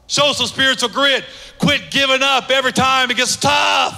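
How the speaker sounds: high, forceful preacher's rallying cry; full-force exhortation. Real, not acted. Preacher urging listeners to quit giving up, loud exhortation.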